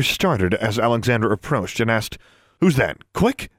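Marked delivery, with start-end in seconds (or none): 2.6-3.5 startled cry, jumping up high on the last word. A narrator's reading. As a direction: caught off guard, voice leaping up. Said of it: "in a startled voice".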